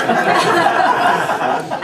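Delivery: loud airy burst of laughter; breathy laugh. Real, not acted. Laughing at the ceiling story.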